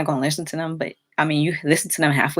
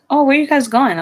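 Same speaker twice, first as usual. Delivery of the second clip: lightly voiced mom, pitched up; a hint of a nosy mother asking; mom's question; 8 semitones higher, 5 dB louder than usual. Narrator imitates her nosy mom asking where they're going, lightly pitched up. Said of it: "My mom was like".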